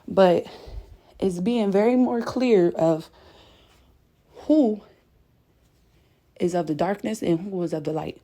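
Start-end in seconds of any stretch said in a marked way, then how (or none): none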